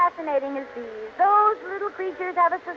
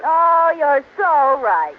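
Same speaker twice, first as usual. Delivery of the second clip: slow, louder, syrupy agreement; gushing agreement; 5 dB louder, much slower than usual. Acted. Wife agreeing to charm Barton, slow syrupy gush. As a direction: stretch the oh, all adoring.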